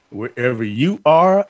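ordinary talk, nothing marked

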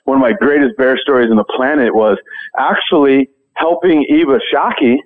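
normal talking voice

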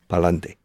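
"Pa'lante" in three quick syllables; Spanish super contraction. Shown as a demonstration. Teacher shows Spanish contraction, clipped three-syllable "Pa'lante". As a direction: squeeze it into "Pa'lante". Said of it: "reducing six syllables to only three".

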